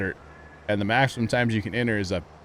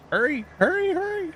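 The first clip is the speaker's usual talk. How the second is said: excited; 3 dB louder than usual. Real, not acted. Raffle about to close.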